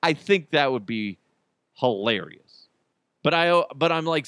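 ordinary delivery

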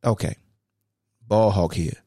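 ordinary delivery

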